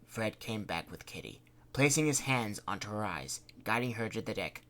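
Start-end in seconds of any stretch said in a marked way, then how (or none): none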